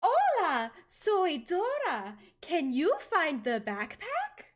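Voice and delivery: high, loud, very slow cartoon kid voice; sing-song it like a kids' show host; Dora impression. Showing off impressions to friend, high slow cartoon Dora. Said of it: "I can also do this really good".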